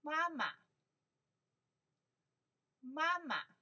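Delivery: 妈妈 said loud and high for the class to copy; model for repeating. Shown as a demonstration. Teacher leading a repeat-after-me drill, loud and clear. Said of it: "repeat-after-me drill".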